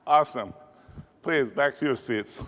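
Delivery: usual speaking voice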